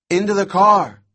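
high, loud call over the radio; urgent radio voice. A narrator's reading. Scratchy voice calls over the handset, urgent shout. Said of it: "said a scratchy voice".

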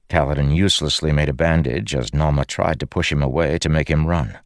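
plain reading voice

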